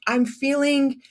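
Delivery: "feeling" drawn out high and left hanging; trailing off. Shown as a demonstration. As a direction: leave "feeling" unfinished, searching for the word. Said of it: "and trail off".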